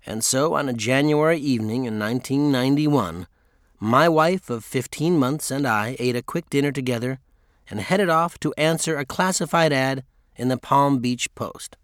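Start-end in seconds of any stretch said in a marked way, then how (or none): none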